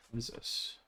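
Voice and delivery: softly whispered, puzzled, under the breath; whispered to self. Real, not acted. Streamer whispers to himself while looking up a game, puzzled. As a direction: murmur it to yourself while reading the screen.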